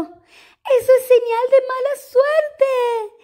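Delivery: put-on high, slow frightened girl; scared girl voice. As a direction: whimper it like a spooked child.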